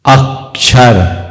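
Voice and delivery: अक्षर said slowly, leaning hard on the first अ; stressed first syllable. Shown as a demonstration. Teacher to students, slow stressed demo of अक्षर. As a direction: draw out अक्षर with weight on the opening अ. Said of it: "तो हमने अ पर जोर दिया".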